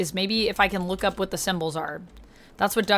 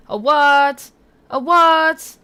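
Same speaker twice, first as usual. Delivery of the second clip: loud surprise; 6 semitones higher, 8 dB louder, much slower than usual. Acted. Baffled by a chat word.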